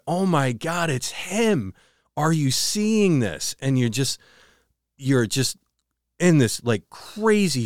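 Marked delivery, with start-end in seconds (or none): 0.1-3.6 higher, strained voice of horror, slowing at the end; imitating a horrified scream without real volume; retold horrified cry. Retelling how partner screamed at the evil presence, mock horror. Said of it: "you just go".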